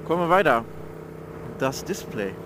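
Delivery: ordinary talk, nothing marked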